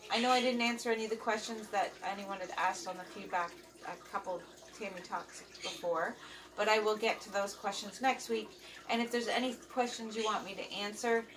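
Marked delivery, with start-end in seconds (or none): none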